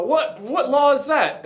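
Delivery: high-pitched, drawn-out indignant question; mock disbelief. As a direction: challenge it in a high, incredulous voice. Fonts challenge the bartender.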